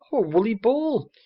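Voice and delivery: a high, loud, breathless cry of delight; little girl crying out in delight. Acted. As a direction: gasp it out, thrilled at the find. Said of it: "cried the little girl".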